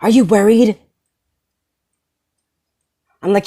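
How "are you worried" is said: higher, louder mock classmate; mock loud question. Imitating the classmate asking him in class, a loud mock question. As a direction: belt it out like the pushy classmate. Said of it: "she says it in the loudest way possible".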